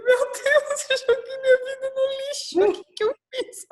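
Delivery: agudo, alto, com a voz chorosa; choro desesperado relembrado. Retold or imitated. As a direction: revive o desespero chorando no banco. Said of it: "desabei a chorar". (chorando alto e agudo no aeroporto, desesperada)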